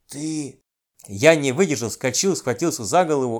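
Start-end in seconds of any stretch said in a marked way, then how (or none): 0.1-0.6 hushed, soft whisper in the ear. A narrator's reading. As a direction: whisper it secretly into someone's ear. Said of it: "шёпотом на ухо мне".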